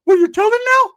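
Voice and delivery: high, loud, whiny cousin voice; Ricky's voice. Retold or imitated. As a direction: squeak it out like a worried goofball. Ricky objecting in dialogue.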